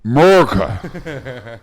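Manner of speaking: slow, loud, drawn-out America; hammed-up patriotic cheer; mock patriotic. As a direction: boom it like a proud anthem. Proud exclamation of America.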